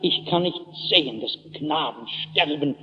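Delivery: understated despair, sinking low and soft; verzweifelt. Acted. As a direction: let the voice fall away in quiet anguish. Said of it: "jetzt reißen ihr die Nerven". (leise, verhaltene Verzweiflung)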